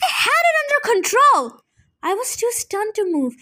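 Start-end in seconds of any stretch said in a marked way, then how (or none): 0.1-1.6 angry yell. A narrator's reading. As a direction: blast him with the blame. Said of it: "she yelled at me".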